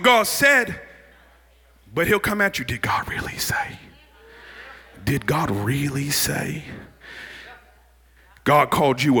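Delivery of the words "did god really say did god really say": sly tempter's undertone; devil's insinuating whisper. Acted.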